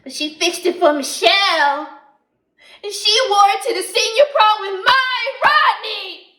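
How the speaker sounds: loud, rising into outrage; jealous anger. Acted. Bitter jealousy of Michelle.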